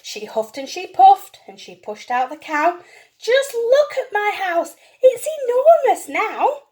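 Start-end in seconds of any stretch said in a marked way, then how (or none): 3.2-6.6 high, slow, amazed delight. Acted. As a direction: marvel at the space. Lady delighted by empty house.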